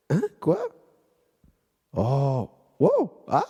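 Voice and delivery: high startled yelp; startled cry. Pretend. Pretend phone call, yelping in mock surprise. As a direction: jump as if the news shocked you.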